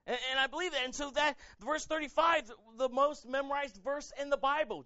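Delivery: high, loud preacher's outcry; impassioned preaching. Acted. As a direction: cry it out to the congregation, stretching key words. Preaches with full force.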